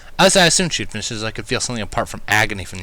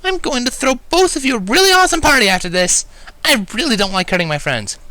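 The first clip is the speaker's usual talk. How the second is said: whiny Pinkie; 4 dB louder than usual. A narrator's reading. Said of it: "Pinkie let out another whine".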